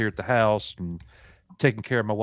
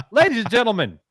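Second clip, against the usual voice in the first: announcer introduction; 4 semitones higher than usual. Pretend. Coach bringing on Tom Connors, showy announcer introduction. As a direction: build up the guest like a ring announcer.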